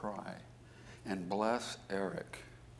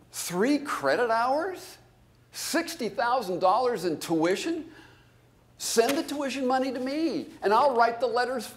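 exasperated rant; 10 dB louder than usual. Real, not acted. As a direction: throw up your hands in loud disbelief. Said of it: "It makes me crazy".

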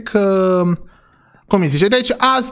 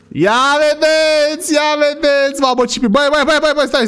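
excited; 8 semitones higher than usual. Acted. Excited showing off toy car.

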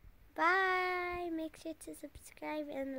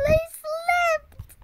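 laughing through the words; high giggly laughter; giggling; 12 semitones higher, 8 dB louder than usual. Real, not acted. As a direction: burst out laughing mid-line. Giggling at slipping parents.

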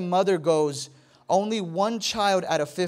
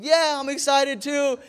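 high, loud mock enthusiasm; put-on excitement; 7 semitones higher, 5 dB louder than usual. Husband reassuring wife about the trip, hammed-up excitement. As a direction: overdo the cheer to please her. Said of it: "why don't you look excited".

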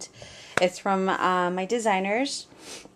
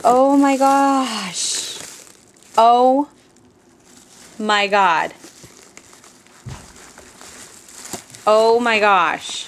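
louder, slow, drawn-out gasps of delight; can't get over the gift; overwhelmed exclamation; 6 dB louder, slower than usual. Real, not acted.

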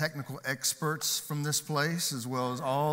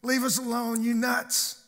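high, loud yell out the window; 11 semitones higher, 7 dB louder than usual. Acted. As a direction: shout it like a fed-up passing driver. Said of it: "he yells out the window".

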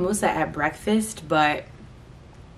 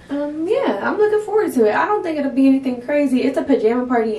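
excited; 6 semitones higher, 4 dB louder than usual. Real, not acted. Excited about party, high loud.

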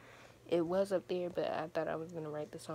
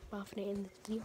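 normal talking voice

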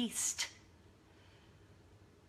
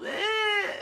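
high, drawn-out mock whine; imitated child whine; 12 semitones higher, 4 dB louder than usual. Mom mimics daughter who is still fussing, whining. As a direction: squeal like a fussing little kid. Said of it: "Luna was still".

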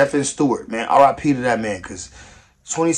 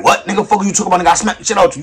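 raised, higher mock threat; hammed-up street threat; imitating threatening dude; 6 semitones higher, 9 dB louder, faster than usual. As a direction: bark it back like a street tough.

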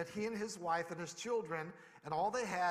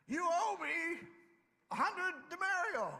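high, loud, shouted demand in a strangling grip; hammed-up, yelling for his money back; mock angry demand; 11 semitones higher, 4 dB louder than usual. Servant throttles fellow servant over a small debt, shouting high and loud.

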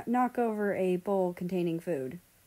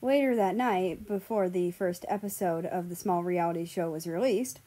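ordinary delivery, nothing marked

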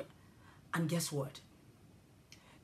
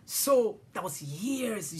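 ordinary delivery

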